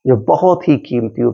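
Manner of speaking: ordinary delivery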